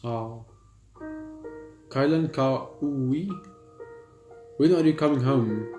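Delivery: ordinary delivery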